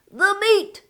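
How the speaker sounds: high shout of the meat; crew roar. Acted. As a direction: echo it like a hungry crew. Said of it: "we all roared".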